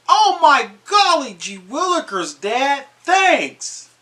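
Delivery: put-on high, gushing gee-whiz kid; wholesome 1950s kid. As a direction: squeal with delight like a storybook child. Child thanking dad for gift.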